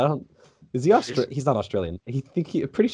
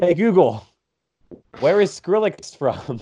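slower, louder repeat for the device; a louder, slower repeated Google command; voice command again; 6 semitones higher, 3 dB louder, slower than usual. Real, not acted. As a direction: say it slower for the device that ignored you. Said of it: "gonna choose now to break?".

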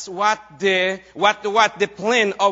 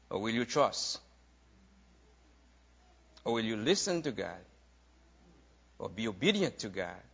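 soft preaching; 9 semitones lower, 6 dB quieter than usual. Acted. Quoted wisdom, dropping softer.

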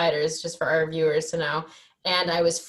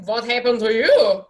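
louder and slow, a touch higher; mock nosy patient; 4 semitones higher, 10 dB louder than usual. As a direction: ask it like a curious stranger in the ER. Imitating ER guy.

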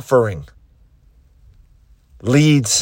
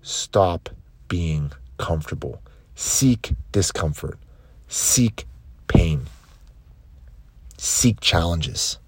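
low, soft, spaced-out imperatives; hushed closing charge; 6 semitones lower, 6 dB quieter than usual. Acted. Coach urging listeners to seek discomfort, hushed closing charge. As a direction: bring it right down for a solemn close.

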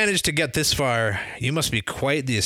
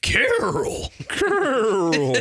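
silly professor voice; 9 semitones higher, much slower than usual. Acted.

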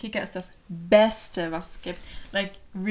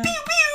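put-on baby chick peeps; 5 dB louder than usual. Laughing chick sound.

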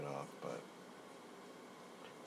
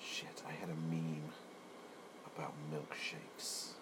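whisper; 6 dB quieter than usual. Real, not acted. In a voice note to his girlfriend, whispering. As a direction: hush it under your breath. Quiet aside in voice message.

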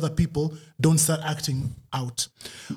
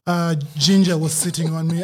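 ordinary talk

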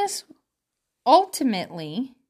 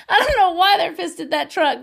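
laughing through the words, higher; 7 semitones higher, 3 dB louder than usual. Real, not acted. Vlogger laughing about dogs barking at a passing truck. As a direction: giggle it out.

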